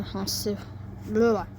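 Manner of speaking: ordinary delivery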